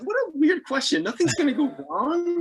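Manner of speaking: high, airy brush-off; breezy dismissal. Retold or imitated. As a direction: wave off the danger lightly. Mocking the form's question.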